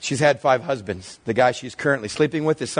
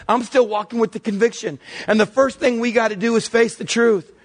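driving, raised sermon voice, hammering the key words; impassioned preaching; 6 semitones higher than usual. Real, not acted. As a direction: preach with rising force and higher pitch. Preacher builds to full force.

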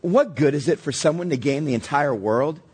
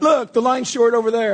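bright, louder, higher mock teen girl; excited girl; 8 semitones higher, 4 dB louder than usual. Dad imitating his daughter's friends, higher and louder, eager. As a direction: imitate an eager seventh grader, pitched up and loud.

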